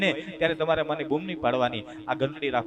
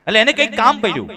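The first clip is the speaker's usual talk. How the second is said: fast, loud higher build-up; rising urgency; 9 semitones higher, 11 dB louder, faster than usual. A narrator's reading. Preacher building to the old father's call, excited. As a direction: rush toward the punchline.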